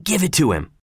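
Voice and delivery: ordinary delivery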